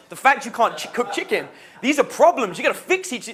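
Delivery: high, retold telling-off; exasperated lecturing. Coach tells student to fix problems, exasperated lecturing.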